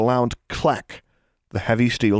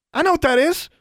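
put-on high-pitched, eager little girl; excited; 4 dB louder than usual. A narrator's reading. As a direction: blurt out the answer in an excited child's voice. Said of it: "said Leah excitedly".

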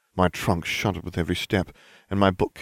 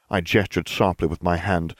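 ordinary talk

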